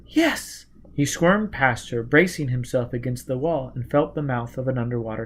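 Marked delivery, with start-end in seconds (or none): 0.1-0.7 put-on young woman's voice. A narrator's reading. Gisbella voiced.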